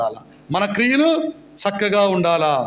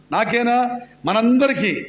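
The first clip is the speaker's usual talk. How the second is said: ordinary talk, nothing marked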